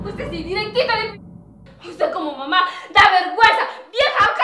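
high, loud insult; angry shout. Acted. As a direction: yell it at her. Nuera insulta a la suegra. (grito de insulto)

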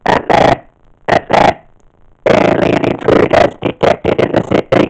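loud, barked mechanical alert; robot alarm voice. Shown as a demonstration. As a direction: announce the invasion like a ring-modulated alien machine. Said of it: "use vocal technique".

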